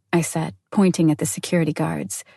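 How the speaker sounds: usual speaking voice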